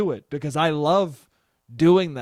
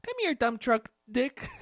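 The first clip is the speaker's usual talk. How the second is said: much higher, syrupy invitation; cutesy pet name; 9 semitones higher than usual. Retold or imitated. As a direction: call your lover over in baby talk.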